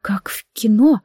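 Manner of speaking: breathy whisper. A narrator's reading. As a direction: barely mouth the words. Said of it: "шевельнулись её пухлые губы".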